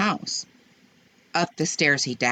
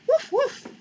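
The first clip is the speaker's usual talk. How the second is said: puppy bark. Acted. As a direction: bark like a small eager puppy. Puppy barks.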